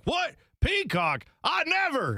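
high-pitched scandalized exclamations; hammed-up disbelief, each word flung out high; mock outrage. Host mimicking fans who only watch college sports, mock outrage.

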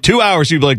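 ordinary delivery

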